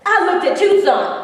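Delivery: ordinary delivery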